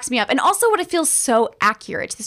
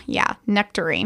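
low, softer, smug agreement; creepy boyfriend; 6 semitones lower, 3 dB quieter than usual. Retold or imitated. The kidnapper agreeing after drugging her, low and smug. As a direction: agree slyly, like he's hiding something.

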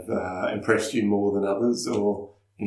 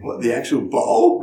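slow, louder, higher puzzled question; mock bewilderment; 4 semitones higher, 6 dB louder, slower than usual. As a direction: imitate a baffled listener, drawn out with a laugh. Acting out puzzled Americans hearing "toilet.

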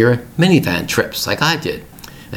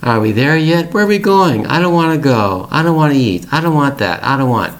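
put-on whiny kids; 5 semitones higher than usual. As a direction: whine like fussy kids in the back seat. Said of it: "they always say".

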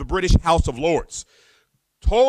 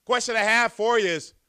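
ordinary talk, nothing marked

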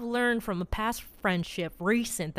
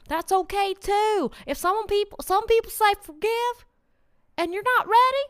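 impassioned, high-pitched motivational push, peaking loud then easing; worked-up motivational speech, voice pitched well up; high-energy pep talk; 7 semitones higher, 3 dB louder than usual. Real, not acted. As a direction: pour all your energy into rousing the listener. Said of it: "that took a lot of energy out of me".

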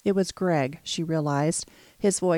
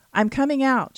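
raised voice, forcing calm; yelling, trying to sound calm; 6 semitones higher, 4 dB louder than usual. A narrator's reading. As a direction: call out loud but keep it steady. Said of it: "she yelled, trying to sound calm".

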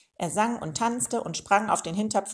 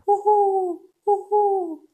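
high, loud hooting; piercingly high acted owl cry; mocking owl call; 13 semitones higher, 7 dB louder, much slower than usual. A narrator's reading. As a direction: hoot tauntingly like an eagle owl. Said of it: "fernen höhnischen Ruf des Wüsten-Uhus".

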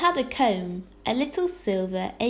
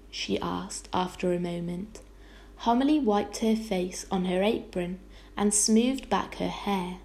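plain reading voice

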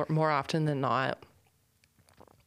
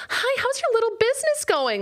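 very high, louder saccharine voice; sugary fake friend; 5 dB louder than usual. A fake-nice acquaintance mocking your business, high saccharine chirp. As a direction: chirp it with fake sweetness to belittle. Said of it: "that way so intentionally".

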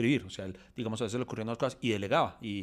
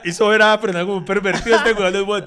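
much louder and higher through laughter; laughing outburst; 10 semitones higher, 16 dB louder than usual. Real, not acted. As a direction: howl with laughter at the joke. Cracking up at friend's joke.

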